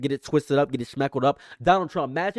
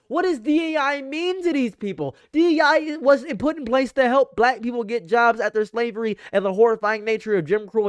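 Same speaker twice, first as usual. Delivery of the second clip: higher, exasperated disbelief; incredulous rant; 7 semitones higher than usual. Real, not acted. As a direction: ask it in high disbelief. Incredulous rant against DEI removal.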